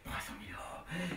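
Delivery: low, hushed echo; awed whisper. Acted. Partner dazzled by three hundred thousand euros, awed whisper. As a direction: whisper the sum in wonder.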